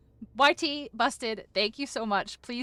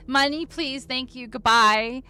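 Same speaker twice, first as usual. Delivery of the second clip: higher mock cheery sign-off, loud on goodbye; mock brisk sign-off; 3 semitones higher, 6 dB louder than usual. Streamer jokes about cashing in and leaving, mock cheery sign-off. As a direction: jokingly take the money and run, punching the goodbye. Mimics taking money and signing off.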